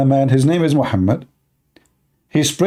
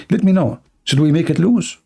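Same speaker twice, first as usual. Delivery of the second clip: ordinary talk, nothing marked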